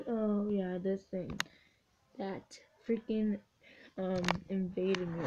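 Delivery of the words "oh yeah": usual speaking voice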